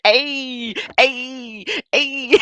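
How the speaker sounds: high hoots breaking into laughter; high-pitched gleeful cheer; amused hooting. Real, not acted. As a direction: hoot along, high, cracking up. Hooting amid laughing fit.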